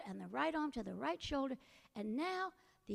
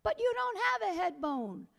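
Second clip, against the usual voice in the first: put-on higher, louder boyish retort; Jack's voice; 7 semitones higher, 7 dB louder than usual. A narrator's reading. Jack argues with the skeleton, higher and louder. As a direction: fire back at the skeleton, high.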